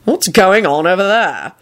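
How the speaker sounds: slow, authoritative question; measured official challenge; demanding. A narrator's reading. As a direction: demand an answer deliberately. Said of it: "he demanded".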